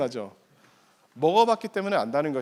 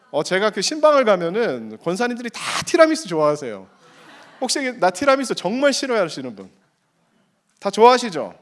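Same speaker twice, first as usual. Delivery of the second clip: normal talking voice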